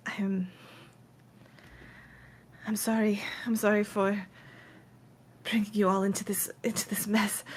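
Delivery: soft, halting, near-whispered apology; small voice, breaking off between phrases; tearful apology. Acted. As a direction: apologize quietly, on the edge of crying. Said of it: "you just see her start to cry".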